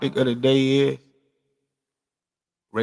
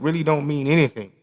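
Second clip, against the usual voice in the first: ordinary talk, nothing marked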